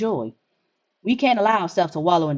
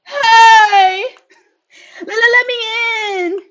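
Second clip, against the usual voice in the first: high, loud sobbing plea, slow and stuttering; crying child; 13 semitones higher, 9 dB louder, much slower than usual. Acted. Scared child outside begging the player to open the door, sobbing. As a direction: beg through tears to be let in.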